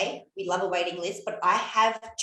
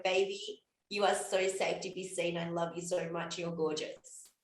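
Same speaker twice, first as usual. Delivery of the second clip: put-on soft, hushed comfort for a small child; tender; 4 semitones lower, 6 dB quieter than usual. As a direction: cradle her with your voice. Tender words to inner child.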